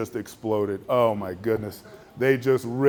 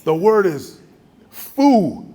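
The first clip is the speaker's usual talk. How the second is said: much higher and louder, building to foo; heated insistence; 7 semitones higher, 7 dB louder, much slower than usual. Retold or imitated. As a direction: hammer the word at him, rising. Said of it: "Then he goes".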